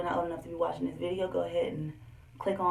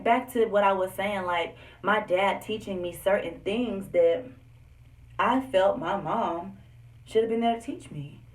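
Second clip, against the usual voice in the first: usual speaking voice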